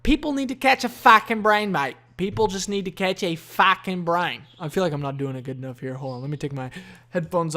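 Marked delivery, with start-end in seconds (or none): 0.1-4.4 loud, hammed-up Aussie podcast host; brash, amateur Australian accent. Shown as a demonstration. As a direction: open with a big Australian "Oi". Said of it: "I can do an Australian accent pretty good".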